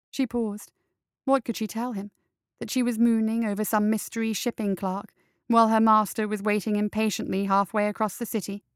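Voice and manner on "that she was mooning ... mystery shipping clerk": usual speaking voice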